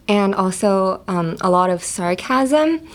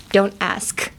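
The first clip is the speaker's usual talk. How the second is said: usual speaking voice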